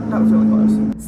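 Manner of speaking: loud over the lawnmower roar. Real, not acted. Speaking over a close lawnmower, loud. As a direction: push the words over a mower going by.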